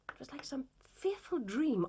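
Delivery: breathy whisper of a remembered nightmare; hushed dread. Acted. Wife confessing to Holmes, whispering in dread. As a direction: whisper it as if the dream returns.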